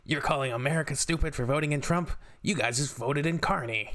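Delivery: sarcastic impression of a touchy American fan; mock butthurt commenter. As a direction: parody an indignant commenter. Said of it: "Americans, like, butthurt in the comments".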